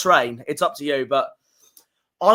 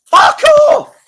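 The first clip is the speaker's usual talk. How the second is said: far higher, very loud furious scream; anguished scream; 11 dB louder than usual. Real, not acted. Fan screaming at the schedulers, furious. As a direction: let out a raw yell of outrage.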